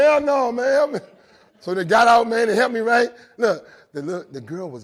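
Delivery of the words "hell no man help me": higher, louder plea for help; pleading. Retold or imitated. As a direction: beg them to help you. Injured speaker pleads for help.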